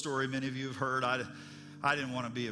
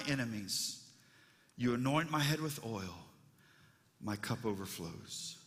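reverent scripture reading; 2 semitones lower than usual. Acted. Preacher reads the psalm.

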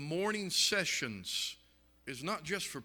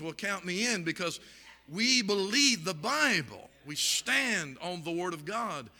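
rising, louder pulpit preaching; preaching at full force; 3 semitones higher, 5 dB louder than usual. Acted. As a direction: drive it home like a revival preacher. Preaching builds to climax.

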